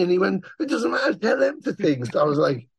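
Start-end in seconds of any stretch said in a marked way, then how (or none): 0.6-2.0 high-pitched, urgent mock persuasion; insistent retelling. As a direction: imitate someone brushing off a protest, pitched up. Retold bandmate brushes off protest.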